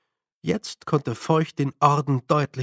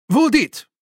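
high, loud dog command; sharp raised shout; calling out; 9 semitones higher, 6 dB louder than usual. A narrator's reading. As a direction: cry out a sudden order to the dog. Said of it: "rief Feucht".

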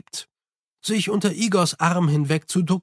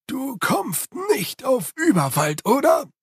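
high servant voice, grumbling about storms; eager Igor, complaining; 9 semitones higher, slower than usual. Acted. Igor asks in disbelief. (hohe, nörgelnde Igor-Stimme)

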